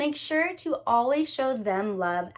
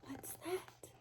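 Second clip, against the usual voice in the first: whisper; 4 semitones higher, 24 dB quieter than usual. A narrator's reading.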